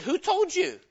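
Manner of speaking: high, sharp question as God. Acted. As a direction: confront him as God.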